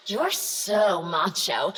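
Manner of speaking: ordinary talk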